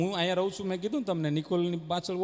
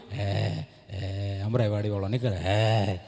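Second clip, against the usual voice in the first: much lower growled dog talk; 11 semitones lower, 4 dB quieter, slower than usual. Retold or imitated. Street dogs talking at their meeting, low dog voice. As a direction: speak as a gruff street dog.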